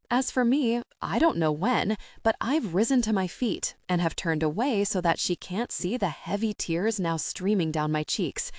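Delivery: plain reading voice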